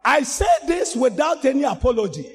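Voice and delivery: preaching at full force to the church; fiery preaching. Acted. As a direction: rebuke the congregation at full force.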